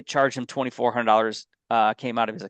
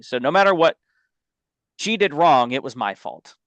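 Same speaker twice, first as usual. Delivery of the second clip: ordinary talk, nothing marked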